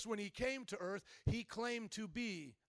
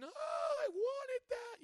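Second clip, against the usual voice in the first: put-on whiny crying child; much slower than usual. Children whining at dad over thrown-out things, high whiny wail. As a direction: squeal it like a child who wants it back. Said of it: "they're, like, crying".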